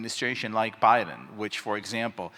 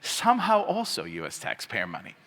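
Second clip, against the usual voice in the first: dropped lower, deadpan punchline; lower, falling aside as the payoff; quoted meme punchline; 4 semitones lower than usual. Retold or imitated. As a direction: drop the pitch for the second caption. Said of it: "And the meme is like".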